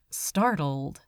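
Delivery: usual speaking voice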